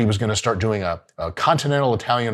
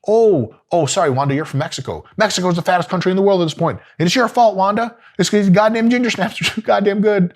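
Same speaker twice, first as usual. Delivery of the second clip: mock outrage; 7 semitones higher than usual. Mock rant at Wanda, laughing.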